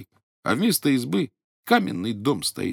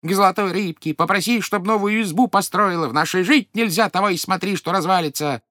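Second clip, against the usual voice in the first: much higher, louder shrill command; angry old wife; 7 semitones higher, 3 dB louder than usual. Acted. Old wife pounces on husband, shrill angry order. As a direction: bark the order at him. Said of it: "старуха опять на него накинулась".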